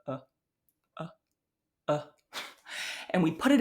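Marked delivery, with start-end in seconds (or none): 0.1-2.0 short neutral "uh"; "uh" as a clean mid central vowel; schwa demo. Shown as a demonstration. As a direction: hold a lax schwa "uh". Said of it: "It's just sounds like this".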